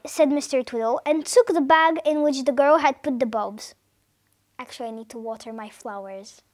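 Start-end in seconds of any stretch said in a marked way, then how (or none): 4.6-6.4 very soft, low murmur to oneself; quiet aside. Real, not acted. Reader remembering the flowers mid-story, hushed low aside. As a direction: drop right down for a private aside.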